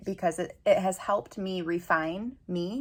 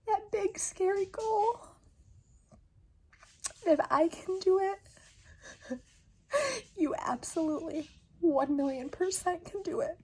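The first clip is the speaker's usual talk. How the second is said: crying encouragement; 9 semitones higher than usual. Real, not acted. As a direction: push through tears to encourage them. Crying over reaching her goal.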